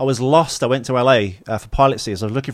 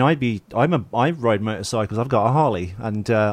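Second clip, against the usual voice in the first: ordinary talk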